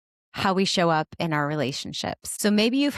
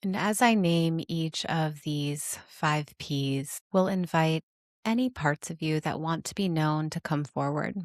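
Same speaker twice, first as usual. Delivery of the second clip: guided meditation; 3 semitones lower than usual. Acted. Host inviting listeners to notice their parts, guided meditation. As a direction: guide them inward, unhurried and calm, settling lower than chatty talk.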